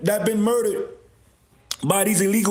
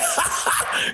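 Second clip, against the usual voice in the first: high cackling laugh; wheezing laugh. Real, not acted. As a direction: burst out cackling. Laughing at his own joke.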